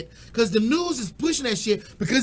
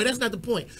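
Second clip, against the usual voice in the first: ordinary talk, nothing marked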